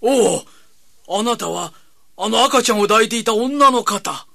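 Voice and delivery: light up as you recognize her; surprised. Acted. Recognizes the visitor.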